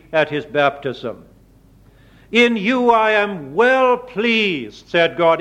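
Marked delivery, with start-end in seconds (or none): 2.3-4.9 slow, raised voice of God; solemn divine voice. Acted. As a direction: speak as God, slow and grand. Quoting God's voice slowly.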